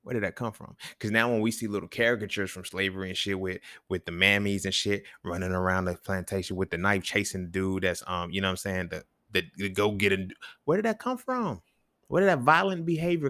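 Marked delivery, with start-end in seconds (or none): 0.0-0.8 softer, near-whispered question; hushed question. Real, not acted. Man asking listeners where hitting comes from, hushed. 10.7-13.3 much higher, incredulous question; high-pitched questioning. Real, not acted. Man asking listeners where violent habits come from, incredulous.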